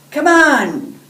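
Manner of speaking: loud, high call across the room. A narrator's reading. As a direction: shout it out to hurry a friend. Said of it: "George calls to Pika".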